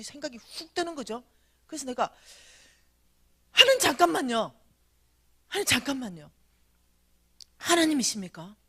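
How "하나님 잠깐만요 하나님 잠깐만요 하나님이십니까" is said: loud, abrupt interjection to stop someone; urgent challenge. Retold or imitated. Speaker halting God to verify the voice, insistent. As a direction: cut in and insist on checking.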